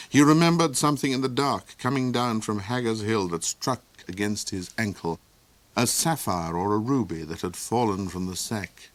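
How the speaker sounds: ordinary delivery, nothing marked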